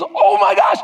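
sudden high alarmed "Oh my gosh"; panicked gasp. Retold or imitated. Husband realizing he's late, alarmed outburst. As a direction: blurt it out in a rush of alarm.

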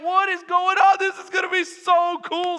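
high, loud excited crowd; freaking out. Acted. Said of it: "the people are freaking out".

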